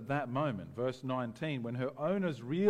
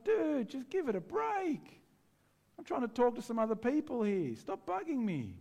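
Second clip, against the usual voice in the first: annoyed brush-off; 8 semitones higher than usual. Retold or imitated. Annoyed Paul to girl.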